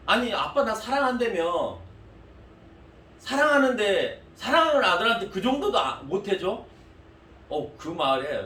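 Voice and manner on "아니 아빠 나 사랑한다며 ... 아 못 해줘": whiny son. Retold or imitated. Son guilt-tripping his dad over sneakers, high whiny plea. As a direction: plead like a sulky teenager who wants sneakers.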